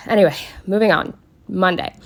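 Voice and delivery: usual speaking voice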